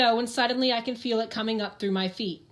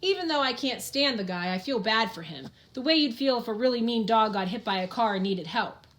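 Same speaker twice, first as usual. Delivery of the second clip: plain reading voice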